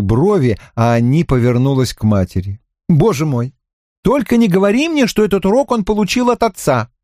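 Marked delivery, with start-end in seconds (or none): 2.9-6.9 exasperated daughter. Acted. Daughter's exasperated quip to mother.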